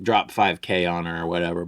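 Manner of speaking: normal talking voice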